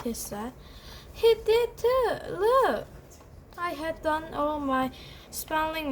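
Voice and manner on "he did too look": whiny tattling. Acted. Brother accusing, crying to mom.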